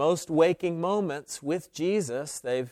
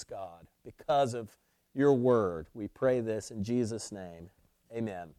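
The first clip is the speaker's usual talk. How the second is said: prayer; 3 semitones lower, 3 dB quieter than usual. Real, not acted. Pastor leading the church in prayer, hushed and reverent. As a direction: pray it quietly with long pauses. Opening prayer before sermon.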